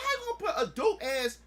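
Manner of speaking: high, heated complaint; fanboy outrage; indignant rant. Retold or imitated. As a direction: rant at the unfairness. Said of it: "I was like".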